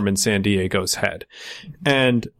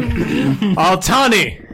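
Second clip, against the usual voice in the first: calling out; 7 semitones higher, 3 dB louder, much slower than usual. Acted. GM calls out to player character.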